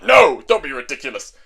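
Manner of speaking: loud, emphatic mock objection; dismissive refusal. Demon Lord stops Atty charging out, dismissive. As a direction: cut them off loudly.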